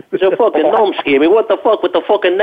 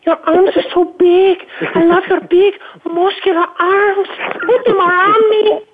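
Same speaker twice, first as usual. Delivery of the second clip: high, breathy falsetto swoon; mock swooning girl; 14 semitones higher than usual. Guest teases Greg as a swooning girlfriend, high falsetto. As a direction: swoon over his arms in a girly falsetto.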